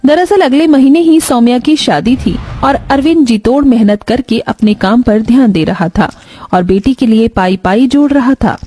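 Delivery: ordinary delivery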